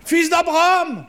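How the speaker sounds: high, loud, far-off call; distant calling voice. Pretend. Voix lointaine répète l'appel. (voix lointaine qui appelle plus fort)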